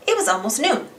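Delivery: ordinary delivery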